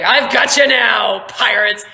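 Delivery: loud, gloating villain; high, loud triumphant shout; Captain Merrick. Acted. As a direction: crow over your cornered enemies, slowly. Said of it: "You see Merrick, and he's laughing".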